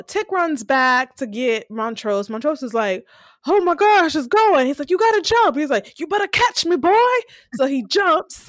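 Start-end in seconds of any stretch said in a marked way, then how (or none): 3.5-4.7 high, loud retold panic; imitating a frantic man in danger; panicked yelling. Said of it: "we hear Montrose yelling". 4.9-5.6 high, loud retold urging; acted shout to someone in danger; urgent yell. 6.0-7.0 high-pitched retold warning; mock-frantic demand; frantic yelling. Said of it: "we hear Montrose yelling".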